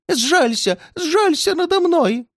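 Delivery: put-on high, plaintive old man's begging; pleading old man. A narrator's reading. Old man begs the redhead for mercy, high and plaintive. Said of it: "жалобно молил старик".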